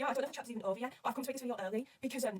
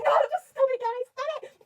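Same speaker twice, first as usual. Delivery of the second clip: much higher and louder, a frightened cry of stop it; panicked plea; 12 dB louder, slower than usual. Retold or imitated. Fan reading the gun scene, crying out in panic. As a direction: beg them to stop, near screaming in fear.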